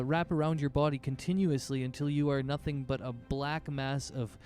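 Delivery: ordinary delivery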